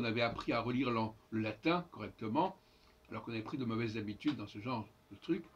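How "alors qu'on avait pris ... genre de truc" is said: ordinary talk, nothing marked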